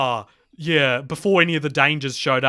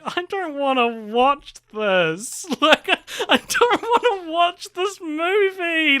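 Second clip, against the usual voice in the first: high, slow, whining protest with a laugh; exasperated disgust; 13 semitones higher, 4 dB louder, slower than usual. Retold or imitated. Podcaster retelling her reaction to the film, high exasperated whine. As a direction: groan it out like you can't bear another second. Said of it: "And I was like".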